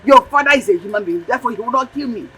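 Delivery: loud, high, forceful command; furious mother barking orders; angry ordering. Acted. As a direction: override all objections angrily. Mother orders child to move money.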